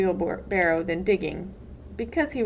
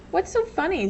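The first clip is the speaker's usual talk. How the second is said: put-on curious boy; 9 semitones higher than usual. A narrator's reading. Zero surprises Stanley.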